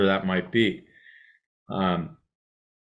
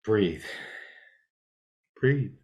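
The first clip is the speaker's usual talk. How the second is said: soft, unhurried self-reminder to breathe; a gentle, hushed breathe, breathe; calm breathing cue; 7 dB quieter than usual. Shown as a demonstration. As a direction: settle yourself, quietly. Calming herself in a demo.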